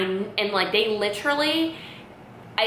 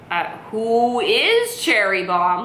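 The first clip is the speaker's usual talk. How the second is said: "is" raised and stretched in "who is"; slow, baffled echo leaning hard on "is"; puzzled echo; 3 semitones higher, 4 dB louder, slower than usual. Retold or imitated. Daughter echoing mom's riddle back, puzzled stress on "is". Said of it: "like it's like a riddle".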